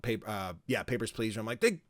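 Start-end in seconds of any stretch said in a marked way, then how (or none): 1.6-1.8 mock outrage. Mocking own complaint about game.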